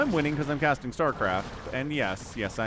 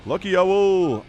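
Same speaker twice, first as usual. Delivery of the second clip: big, slow, louder call to a viewer; excited shout-out; 4 semitones higher, 8 dB louder, slower than usual. Real, not acted. Caster greets a viewer in chat, excited shout. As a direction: greet the name like a cheer.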